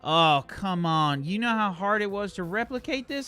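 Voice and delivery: high-pitched, pleading complaint; protesting crewman. Acted. Wayne protests leaving his weapon behind, high-pitched complaint. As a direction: argue back, voice climbing high.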